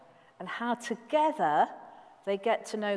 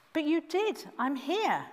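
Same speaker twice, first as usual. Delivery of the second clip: put-on high-pitched boy's protest; insistent protest; 8 semitones higher than usual. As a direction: insist to the master that he called. Boy protests to his master.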